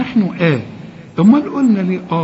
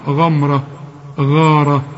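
ordinary talk, nothing marked

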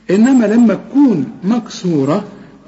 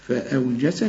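ordinary delivery

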